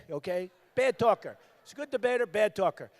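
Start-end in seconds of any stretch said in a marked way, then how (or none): none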